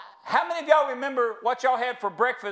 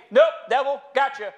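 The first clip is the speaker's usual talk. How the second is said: defiant; 2 semitones higher, 5 dB louder than usual. Shown as a demonstration. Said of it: "that you can say".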